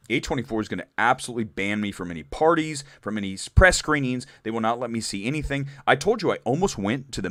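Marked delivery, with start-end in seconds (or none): none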